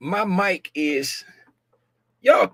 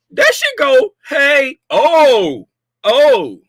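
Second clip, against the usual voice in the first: excited; 9 semitones higher, 6 dB louder, much slower than usual. Real, not acted. Excited reaction to chart.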